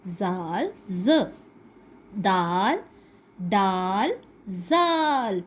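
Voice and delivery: drawn-out zaal and daal letter names; letter sound demo. Shown as a demonstration. Teacher sounding out letters for a child. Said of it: "تو میرا نام ہے زال".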